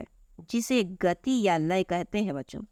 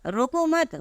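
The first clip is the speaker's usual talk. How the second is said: रोको मत joined, pitched high; high, unbroken रोको मत with the break after मत; रोको मत as one phrase; 7 semitones higher, slower than usual. Shown as a demonstration. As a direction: run रोको straight into मत, high, then stop. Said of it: "ये दूसरे sentence को देखिए".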